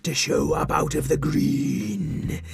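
slow eerie whispered monster; creepy whisper. Acted. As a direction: drag out a sinister whisper. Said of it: "said Cthunkel".

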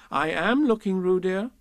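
high-pitched mild reproof; rather severe. Acted. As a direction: correct a child firmly. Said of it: "said Kanga rather severely".

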